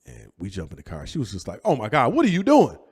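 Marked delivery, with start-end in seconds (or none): 1.7-2.8 high, loud imitation of alarm; mock panicked exclamation. Aunt to nephew as he jumps in car, mock panic. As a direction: imitate an aunt crying out in disbelief.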